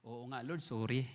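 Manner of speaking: soft, low, embarrassed sorry; sheepish apology. Retold or imitated. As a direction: apologize quietly like a caught child. Apologizing to Lord, retold.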